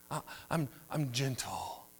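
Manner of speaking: breathy, trailing soft voice; mock-gentle, let it fade at the end; a parody of gentleness, soft and drifting off. Shown as a demonstration. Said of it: "If you do it like that voice".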